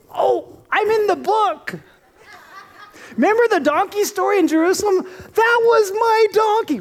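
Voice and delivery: high, laughing brag rising to a shout; donkey owner bragging in heaven, laughing and shouting; gleeful boasting. Retold or imitated. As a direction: show off with delighted laughter.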